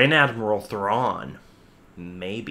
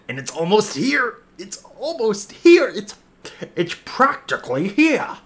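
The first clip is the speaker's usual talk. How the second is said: high, squealing anticipation; giddy excitement; 10 semitones higher, 3 dB louder than usual. Real, not acted. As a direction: burst with fan excitement, voice shooting up. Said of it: "I'm so, so, so excited".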